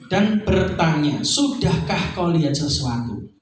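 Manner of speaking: slow, low, gentle question; slow tender question; slow reading. Acted. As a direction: ask it slowly, as Jesus. Reading Jesus' question slowly.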